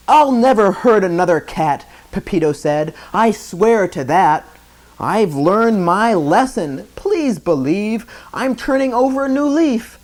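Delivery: put-on a boy swearing earnestly; pleading promise. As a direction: plead to be believed.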